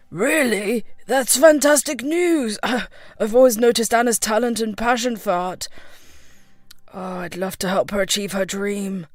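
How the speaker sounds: understated excitement in a man's voice; pleasantly thrilled by the news; excited. A narrator's reading. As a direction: react with keen, contained delight. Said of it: "eyes lit up with excitement".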